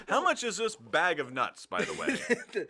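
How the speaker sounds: loud high calling out; pushy customer. Pretend. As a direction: call over a shop clerk eagerly. Role-played customer asking the clerk.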